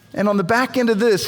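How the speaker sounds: ordinary talk, nothing marked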